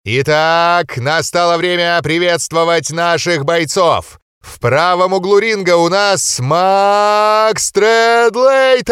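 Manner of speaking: громкий, протяжный анонс на весь зал; ведущий боксёрского ринга. A narrator's reading. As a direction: raise the crowd like a ring announcer. Introducing fighter, drawn out. (ведущий на ринге заводит толпу, громко и протяжно)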